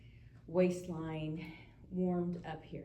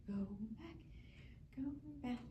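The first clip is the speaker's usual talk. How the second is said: soft whispered coaching cue; whispering; 6 semitones higher, 10 dB quieter than usual. Real, not acted. Coach counting arm circles.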